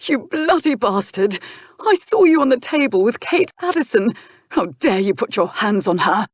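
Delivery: high, loud accusing yell; furious shout. Acted. Nephew screams at uncle over Kate, furious shout. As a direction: hurl it at him like a betrayed young man.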